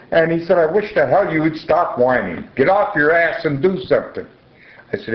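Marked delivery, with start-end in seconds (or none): 0.6-4.3 higher, scolding husband's quote; angry husband. Retold or imitated. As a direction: retell his harsh nagging, raised pitch. Husband's harsh words retold.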